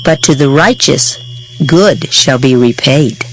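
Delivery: usual speaking voice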